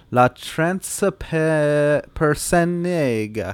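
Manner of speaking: slow, careful Italian accent; Italian accent, each syllable drawn out. Real, not acted. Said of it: "'Cause I said it with an Italian accent?".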